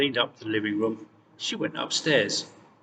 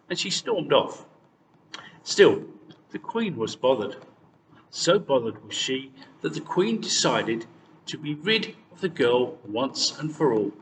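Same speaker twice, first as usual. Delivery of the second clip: usual speaking voice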